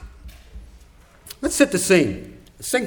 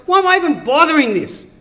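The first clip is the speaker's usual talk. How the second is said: higher-pitched exasperated complaint; frustrated; 7 semitones higher than usual. Acted. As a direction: voice an apostle fed up with explaining himself. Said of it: "he's frustrated".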